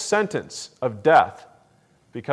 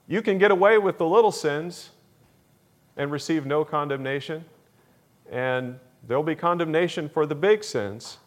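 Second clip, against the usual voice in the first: ordinary delivery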